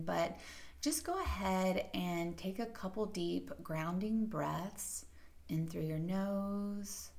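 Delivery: soft, even meditation voice with long pauses; guided meditation. Acted. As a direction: softly guide the listener into settling and breathing.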